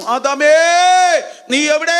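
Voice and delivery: acted anguished call; wailing cry. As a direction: call out for someone lost, crying out high. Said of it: "ഞാൻ നിലവിളിച്ച് കരഞ്ഞു".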